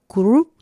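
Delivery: curru with a strong trilled rr; rolled double r. Shown as a demonstration. Teacher showing the rolled r, loud whispered curru. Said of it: "como una doble R".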